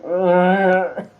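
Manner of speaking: disapproving groan. Acted. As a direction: groan out your dismay at the idea. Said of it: "he makes his face like".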